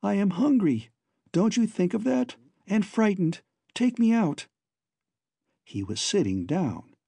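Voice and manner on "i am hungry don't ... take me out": high pleading voice, a little frightened; small high girl's voice, pleading. A narrator's reading. Babs begs the giant doctor to take her out, high and pleading. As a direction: ask to be let out, small and scared.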